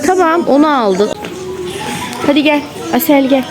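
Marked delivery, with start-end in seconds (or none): none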